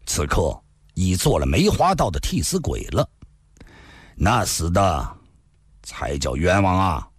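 smiling irony. A narrator's reading. Li Xunhuan, smiling, mocks Zhao for nearly killing him. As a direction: needle him with a polite smile. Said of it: "微笑地说道".

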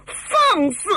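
high, explosive outburst; outraged rebuke. A narrator's reading. Heroine reacts to the stranger's insult, outraged. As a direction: cut her down in a high-pitched flare of temper.

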